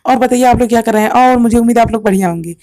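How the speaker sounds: quick, bright-paced demo take; fast and high. Shown as a demonstration. Teacher demoing fast speech to students, fast. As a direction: rattle it off fast to show high frequency. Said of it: "मैं अब दो तरीके से बोलूँगा".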